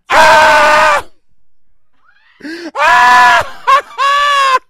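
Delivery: high, loud shrieking fan scream. Retold or imitated. Comic imitating the concert crowd, a high loud shriek. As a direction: shriek like a crowd at a boy band. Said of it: "all you hear is".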